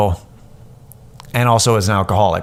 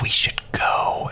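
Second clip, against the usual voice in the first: breathy retold whisper, slowed; 6 dB quieter, slower than usual. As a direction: hush it like sneaking away. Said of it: "She's like".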